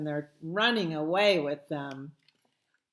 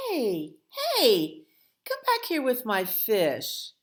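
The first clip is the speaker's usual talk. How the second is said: calling out; 4 semitones higher, 5 dB louder than usual. Acted. Bear calls after thieves.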